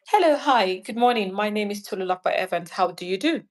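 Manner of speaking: assured, brisk self-introduction with a lifted greeting; confident. Shown as a demonstration. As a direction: introduce yourself with poise, no hesitation. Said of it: "I've gotta be confident".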